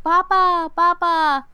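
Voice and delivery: high, loud, sung-out mock call; calling out for Papa. Retelling her running through the fields, high loud calling. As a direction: call across a field in imitation. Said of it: "She's running through the fields".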